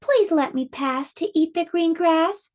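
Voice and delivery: high pleading little goat. Acted. Littlest goat begs the troll to pass, high. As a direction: beg the troll in a small voice. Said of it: "said the littlest billy goat Gruff".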